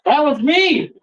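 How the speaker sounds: high, triumphant exclamation; excited reveal. Retold or imitated. Man at the bar reveals himself to strangers, high excited exclamation.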